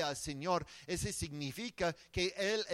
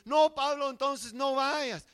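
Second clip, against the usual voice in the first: much higher, louder pleading; pleading crowd; 9 semitones higher, 9 dB louder than usual. Acted. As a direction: beg him loudly not to go. Friends weeping, begging Paul not go.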